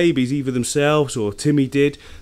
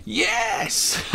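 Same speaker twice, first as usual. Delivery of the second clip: high, drawn-out exclamation; triumphant cheer; 9 semitones higher than usual. Real, not acted. Owner cheering his turtle's catch, triumphant.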